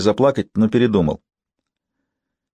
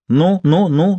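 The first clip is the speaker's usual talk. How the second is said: put-on high, jerky old nanny; nervous nanny prodding; fussy old nanny; 9 semitones higher, slower than usual. A narrator's reading. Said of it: "с каждым словом, дёргая головой".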